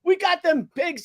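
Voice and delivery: high put-on voice of a grateful accomplice; imitating the suspect. Suspect thanking his accidental accomplice, mock jubilant. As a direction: play a crook cheering his helper. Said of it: "he's like".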